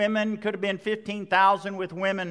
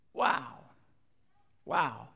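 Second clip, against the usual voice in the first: hushed awe; 8 semitones lower, 6 dB quieter than usual. Real, not acted. Awe at walking on water.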